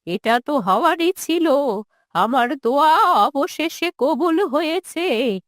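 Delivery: put-on quavering old mother, bitterly rejecting her son; wounded, resentful old woman. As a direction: refuse to hear of him, words drawn out.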